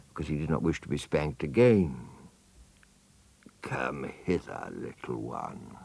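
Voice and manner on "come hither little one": low, slow, soft luring voice; crocodile. Acted. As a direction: coax the child in, slow and sly. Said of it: "said the crocodile".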